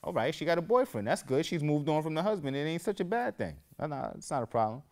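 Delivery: high, loud whoas, backing off fast; mock flustered reassurance; flustered backpedal. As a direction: act caught off guard, smoothing it over. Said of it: "So I'm like".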